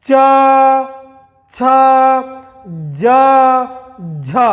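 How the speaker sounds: slow च, छ, ज, झ, long pause after each; sounding out letters. Shown as a demonstration. Teacher showing tongue on palate, slowly. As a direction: say each palatal alone and clear. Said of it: "देखिए".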